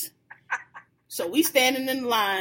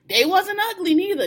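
ordinary talk

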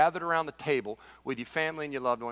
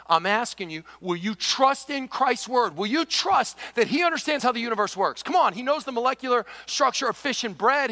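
higher, louder, rising appeals to the congregation; preaching at full force; 10 semitones higher, 9 dB louder than usual. Acted. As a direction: urge them, lifting the voice on every question.

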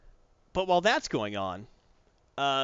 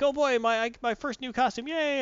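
much higher, giddy cheer; excited kid; 10 semitones higher than usual. Retold or imitated. Kid thrilled at first costume, high and giddy. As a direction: squeal with delight at the costume. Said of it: "you see them like".

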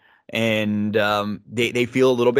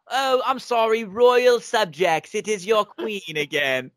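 a hint of her haughty queen accent, higher; lightly put-on regal accent, royal subjects drawn out slowly; Zelina's queen accent; 8 semitones higher, slower than usual. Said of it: "gets out of the accent".

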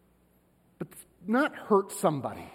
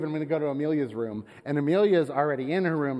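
ordinary talk, nothing marked

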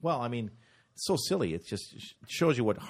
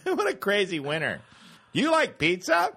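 high, raised mock stand-up patter; hammed-up clueless comic shouting; shouting out things; 8 semitones higher than usual. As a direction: holler it at an imaginary crowd. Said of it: "Just shouting out things".

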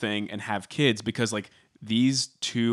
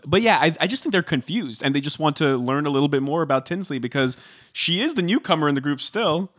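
usual speaking voice

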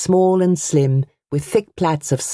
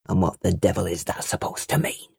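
low menace; 8 semitones lower, 6 dB quieter than usual. A narrator's reading. Uncle voiced in quarrel.

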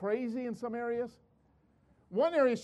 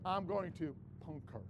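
hushed; 7 semitones lower, 10 dB quieter than usual. Retold or imitated. Sneaking in dark hotel room.

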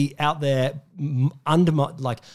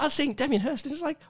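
ordinary talk

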